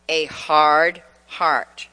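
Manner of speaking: ordinary talk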